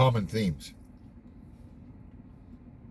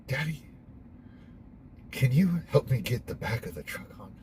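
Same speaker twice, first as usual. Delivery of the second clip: put-on higher, softer child's voice asking dad; calm little daughter; 5 semitones higher, 5 dB quieter than usual. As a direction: imitate a small girl asking calmly for help. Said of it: "very calmly".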